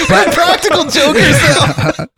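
high, slow, giggling through the pun; laughing repeat. Real, not acted. Co-host echoing the practical jokers pun, still laughing. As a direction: laugh as you echo the joke.

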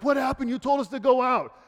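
high, loud mock-tearful protest; imitated crying complaint. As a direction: wail it in imitation of defeated people. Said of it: "they're literally crying".

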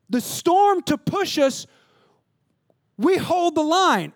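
high, loud, slow preaching chant; rallying cry. Retold or imitated. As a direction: drive each line home like a war cry. Rallied soldiers chant, full force.

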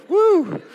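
a loud, high flirty whoop; playful whoop. Pretend. Pastor to congregation after a cheesy compliment, playful loud whoop. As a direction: let out a teasing woo.

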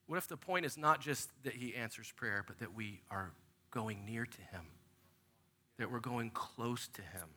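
very soft, low and intimate; quiet, reverent preaching; hushed sincerity. Real, not acted. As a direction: drop to a gentle hush. Preacher softens for his main point.

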